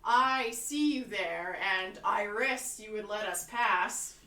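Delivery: slow, loud declamation; in-character shout. Acted. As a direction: call it out loudly, drawing out each word. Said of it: "I'm gonna shout".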